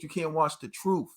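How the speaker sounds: normal talking voice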